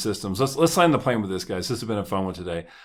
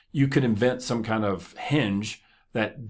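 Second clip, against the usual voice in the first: ordinary talk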